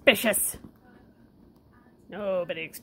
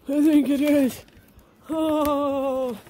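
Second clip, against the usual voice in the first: higher, louder put-on pet voice; voicing the pets; 6 semitones higher, 6 dB louder, much slower than usual. Owner speaking for the begging pets, squeaky and loud.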